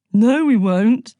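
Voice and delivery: slow, loud misery moan. Acted. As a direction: drag it out like everything is hopeless. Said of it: "moaned Penthos".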